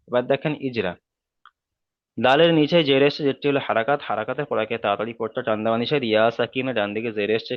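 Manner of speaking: normal talking voice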